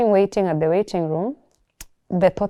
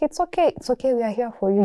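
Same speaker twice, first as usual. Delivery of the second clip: higher, soothing reassurance; comforting; 5 semitones higher than usual. Retold or imitated. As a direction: soothe her gently, pitched up. Sister comforting her, voiced.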